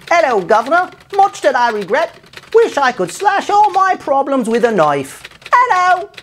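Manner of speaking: high, hammy Cockney Jack the Ripper; mock Cockney accent. Jack the Ripper's apology in a hammy high Cockney. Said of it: "I'm not even gonna comment on that accent".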